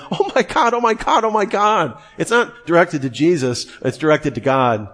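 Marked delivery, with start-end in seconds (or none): 0.1-2.0 higher, laughing mock gush; mock excited exclamation. Thomas seeing the risen Jesus, mock giddy gush. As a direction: parody Thomas as a giddy game show winner. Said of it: "Thomas is saying".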